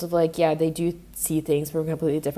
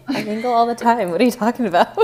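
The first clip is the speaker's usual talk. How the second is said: laughing; 5 semitones higher, 5 dB louder than usual. Real, not acted. Teased about mingling, laughs.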